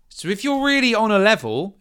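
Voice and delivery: high, loud wary challenge; squinting suspicion. Acted. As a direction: size them up distrustfully. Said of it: "she really squints at you".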